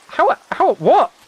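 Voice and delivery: high astonished stammer of disbelief; real shock. Real, not acted. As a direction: caught off guard, blurting. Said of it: "I'm very surprised".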